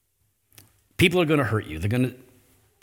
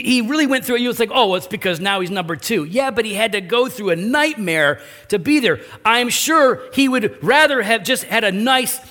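raised, higher, louder and urgent; passionate preaching; 8 semitones higher, 4 dB louder than usual. Real, not acted. Preacher to congregation, passionately pressing the point. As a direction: preach it up with rising fire.

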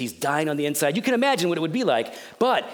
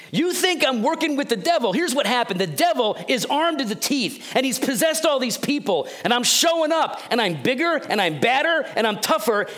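voicing Jesus; 10 semitones higher than usual. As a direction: proclaim it like a confident champion who just showed up. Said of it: "Jesus is saying".

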